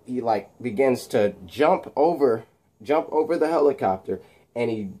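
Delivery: ordinary talk, nothing marked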